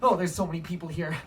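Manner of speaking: plain reading voice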